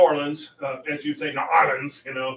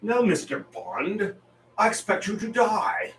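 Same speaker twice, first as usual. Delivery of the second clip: Bond villain line; 7 semitones higher than usual. Retold or imitated. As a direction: relish the threat like Goldfinger. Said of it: "That's gotta be the most famous Bond line".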